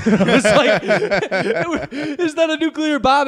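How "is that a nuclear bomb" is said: put-on high, laughing little-kid question; frightened kid. Kid asks dad about sirens, high laughing child voice. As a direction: ask it like a scared child at the sirens.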